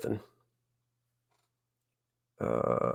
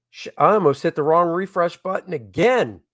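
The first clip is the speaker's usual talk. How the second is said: normal talking voice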